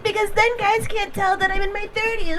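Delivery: high cutesy mock voice; high-pitched mock baby talk; put-on girly voice. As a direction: mimic a woman sounding younger for men. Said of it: "Why are you talking like that".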